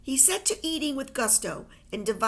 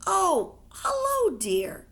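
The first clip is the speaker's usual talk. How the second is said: high, sing-song cooing mother; 8 semitones higher, 4 dB louder, much slower than usual. A narrator's reading. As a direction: coo it like a doting mum. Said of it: "his mum cooed".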